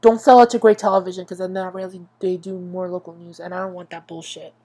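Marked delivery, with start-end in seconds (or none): none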